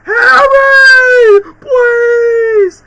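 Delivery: crying scream, very high and very loud; anguished scream. Retold or imitated. Gamer imitating Peter screaming to Harry, crying scream. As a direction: scream the name with all you have, crying. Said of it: "it just goes".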